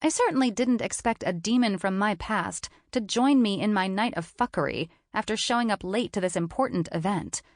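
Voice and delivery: usual speaking voice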